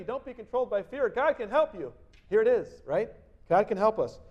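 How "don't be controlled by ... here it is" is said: ordinary talk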